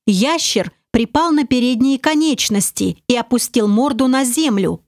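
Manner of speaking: ordinary delivery, nothing marked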